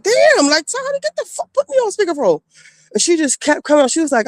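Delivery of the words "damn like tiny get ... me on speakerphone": higher, excited friend voice on the line; friend on the phone. Retold or imitated. Friend on the phone interrupts, lightly voiced higher impatient call. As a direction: play the impatient friend, pitched up.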